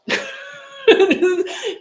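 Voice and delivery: loud high laugh; high burst of laughter. Real, not acted. Laughing at own joke.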